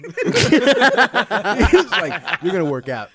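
bursting into laughter while quoting; laughing. Real, not acted. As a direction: laugh through the quote. Laughing retelling interviewer.

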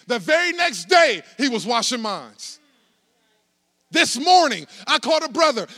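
climbing loud preacher cry; full-force preaching. Acted. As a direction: preach it up to the climax.

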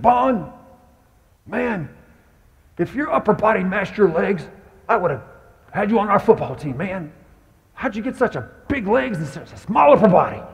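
high, loud gruff coach impression; imitating the football coach, high and loud. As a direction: bark it like an old football coach. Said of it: "he talked like this".

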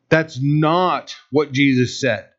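normal talking voice

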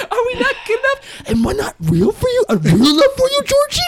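high, loud, taunting clown voice; creepy clown voice. Pretend. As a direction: coax the child like a creepy clown. Clown impression after Pennywise joke.

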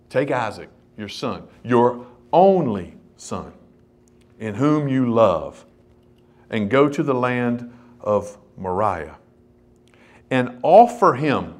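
slow, low-pitched recitation, weighing each phrase; scripture reading. Acted. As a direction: read God's command out slowly and solemnly. Reads God's command from scripture.

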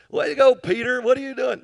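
mocking shout. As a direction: heckle Peter like the boys in the boat. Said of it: "you can imagine the boys in the boat".

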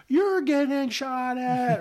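retold scream. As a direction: imitate a soldier screaming back from afar. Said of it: "He's screaming at me".

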